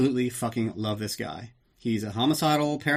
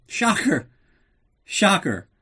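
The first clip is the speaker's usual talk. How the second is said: sarcastic shocker; 8 semitones higher, 4 dB louder, slower than usual. Real, not acted. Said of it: "I didn't look as good".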